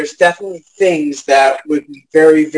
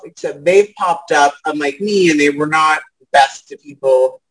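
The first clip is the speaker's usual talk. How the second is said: ordinary delivery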